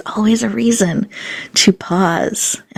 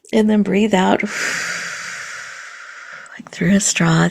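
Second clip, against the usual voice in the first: guided meditation; 2 semitones higher than usual. Acted. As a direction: lead them gently through the breath. Leads a guided breathing exercise.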